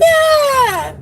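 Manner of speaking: high, loud delighted cry; thrilled shout; excited yelp. Real, not acted. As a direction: squeal of joy at a friend. Excited exchange with friend.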